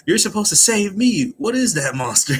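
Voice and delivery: high, loud mock complaint; indignant yell. Nami yells at Usopp for not saving her, high and loud. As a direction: scold him like she's the victim. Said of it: "Nami goes".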